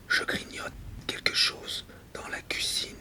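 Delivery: very soft, unvoiced whisper. Alone at night with a mouse in the kitchen, whispered. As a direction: whisper it like a secret night-time observation.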